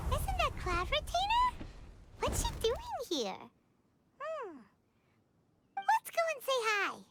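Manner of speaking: usual speaking voice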